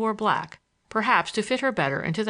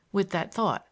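ordinary delivery, nothing marked

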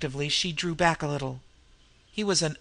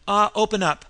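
sharp, loud, higher snap; snapped; 5 semitones higher, 7 dB louder than usual. A narrator's reading. Nan snapping at the stalling old fence, loud and sharp. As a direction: bite it off impatiently. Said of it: "she snapped".

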